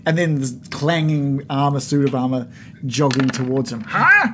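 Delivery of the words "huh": sharp high loud huh; startled goblin. Retold or imitated. DM voicing goblin surprised by jogging armor, high and sharp. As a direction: goblin caught off guard. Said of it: "He's like".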